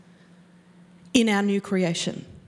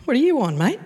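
offhand brush-off. Retold or imitated. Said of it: "But he just goes".

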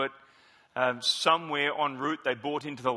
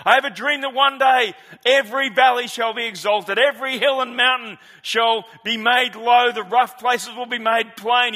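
put-on higher, louder Southern preacher accent; Martin Luther King accent; 7 semitones higher, 8 dB louder than usual. A pastor quoting the speech in a Southern preacher accent, louder. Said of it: "I just want to do his accent".

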